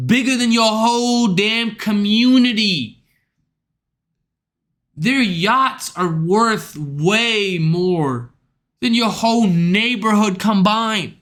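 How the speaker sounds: ordinary delivery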